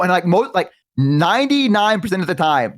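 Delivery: ordinary talk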